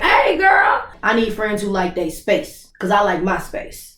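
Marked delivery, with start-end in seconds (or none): 0.0-0.8 high, loud cheery hello; excited greeting. Retold or imitated. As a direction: pop up with a bright greeting. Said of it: "it just be like".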